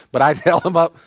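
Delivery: ordinary talk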